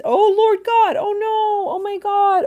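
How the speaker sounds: high, loud cry of alarm; shocked dismay. Real, not acted. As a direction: cry out in real horror at bad news. Reacting to viewer's bad news.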